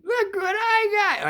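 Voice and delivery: very high, drawn-out squeal of excitement; squeaky mock boasting, stretched out; excited kid brother. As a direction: imitate a thrilled child in a sky-high voice. Bro showing off the bullets.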